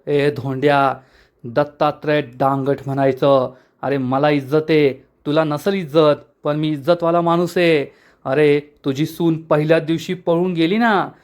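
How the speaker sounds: usual speaking voice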